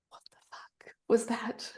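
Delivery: stunned, whispered disbelief; shocked whisper. Retold or imitated. Retelling her reaction to hearing a voice, shocked whisper. Retold shock, said under breath.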